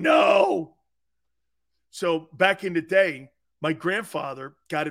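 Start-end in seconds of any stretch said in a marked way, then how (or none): 0.0-0.7 loud, drawn-out mock "no"; imagined shouted protest. Aunt reacting to a story about to be told, mock shout. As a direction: cry out in pretend dismay. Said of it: "My aunt's probably going".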